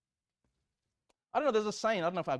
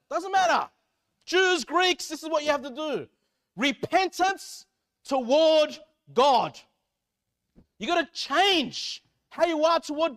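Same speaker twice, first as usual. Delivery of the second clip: high, shouted pulpit exhortation; full-force preaching; 11 semitones higher, 12 dB louder than usual. Real, not acted. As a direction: thunder it out, leaning hard on key words.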